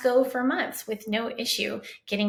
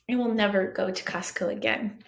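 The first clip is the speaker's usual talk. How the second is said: ordinary talk, nothing marked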